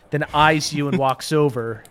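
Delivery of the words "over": ordinary talk, nothing marked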